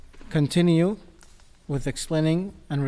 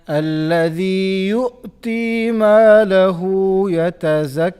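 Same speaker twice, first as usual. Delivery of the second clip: higher, louder chanted recitation; melodic Quran recitation; 4 semitones higher, 8 dB louder than usual. Shown as a demonstration. Teacher chants the ayah for the student to follow. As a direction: sing out the ayah with tajweed.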